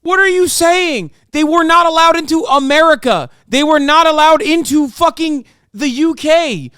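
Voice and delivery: very high, loud angry yelling; furious rant. Real, not acted. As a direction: shout in outraged disbelief. Furious outburst at guest.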